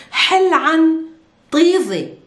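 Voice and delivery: "حل عن طيزي" said loud and clear, word by word; Arabic demo. Shown as a demonstration. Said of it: "I'm telling you".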